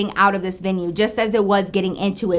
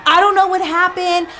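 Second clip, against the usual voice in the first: higher, stretched-out retold yell; mock yell; 6 semitones higher than usual. Friend breaking news over blasting music, mock yelling. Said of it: "trying to, like, yell at her".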